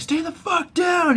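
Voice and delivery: high, slow, strained command; mock angry order. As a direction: imitate someone yelling at a fallen opponent. Man beating Theon orders him.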